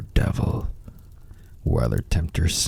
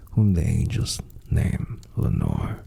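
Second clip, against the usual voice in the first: ordinary delivery, nothing marked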